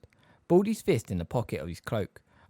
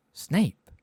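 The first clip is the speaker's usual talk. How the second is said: higher, louder blank echo; blank surprise; 7 semitones higher, 5 dB louder than usual. A narrator's reading. Harry hears who wants him, blankly. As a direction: repeat the name, nonplussed. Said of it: "said Harry blankly".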